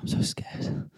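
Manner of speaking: breathy whispered fake panic; mock scared whisper. [name] faking a panic attack backstage, mock whisper. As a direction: whisper it like you're faking terror. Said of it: "fake panic attack".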